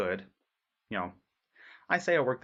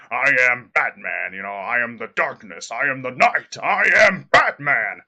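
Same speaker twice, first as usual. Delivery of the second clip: Kevin Conroy's Batman; 10 dB louder than usual. Shown as a demonstration. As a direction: growl it out like a brooding dark hero. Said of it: "try to mimic Kevin Conroy's Batman".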